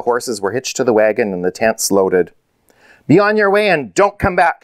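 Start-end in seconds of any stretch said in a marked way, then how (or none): 3.1-4.6 high-pitched, moderately raised send-off; angry shout. A narrator's reading. Riders drive the family away, angry shouting. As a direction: shout them off the land. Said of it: "shouted the men".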